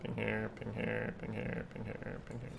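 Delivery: soft repeats trailing away; murmured chant dying down; fading repeats. Pretend.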